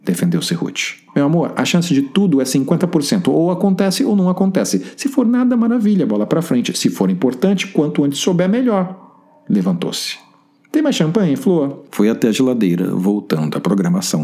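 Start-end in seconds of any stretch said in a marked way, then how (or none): 1.1-8.9 high, bouncy and theatrical; breezy upbeat pep talk; lively high friend voice. Acted. Wagner voiced character, high lively. 10.7-11.8 lively high friend voice. Acted. Wagner voiced again.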